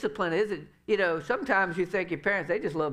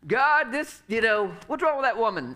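indignant complaint; 5 semitones higher, 5 dB louder than usual. Retold or imitated. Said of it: "And I was all boudade".

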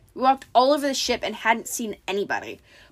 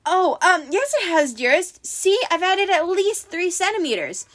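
high, hesitant fake-bride voice; nervous pretend bride, pitched high; falsetto; 8 semitones higher than usual. A narrator's reading. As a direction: stall sweetly in a high falsetto. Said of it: "in his falsetto voice".

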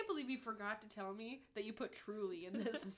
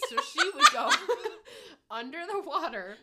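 higher, louder, dissolving into laughter; laughing; 4 semitones higher, 12 dB louder than usual. Real, not acted. To her friend, laughing about her shark prank. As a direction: laugh too hard to finish.

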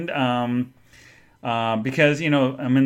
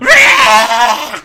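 loud high frustrated roar; angry roar; 10 semitones higher, 14 dB louder than usual. Retold or imitated. Road-raging customer letting out a roar. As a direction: let out a road-rage roar. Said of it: "I just got all road raging".

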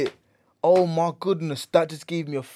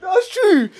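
laughing; 4 dB louder than usual. Real, not acted. Laughing agreement.

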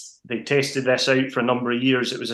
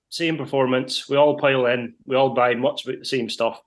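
usual speaking voice

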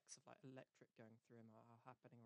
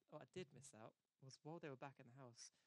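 normal talking voice

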